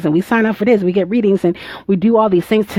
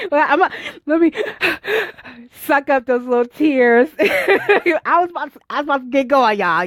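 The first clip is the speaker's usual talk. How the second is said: real laughing while holding back tears; laughing through tears; 7 semitones higher than usual. Real, not acted. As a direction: giggle as you wipe the tears away. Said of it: "like, I'm about to cry".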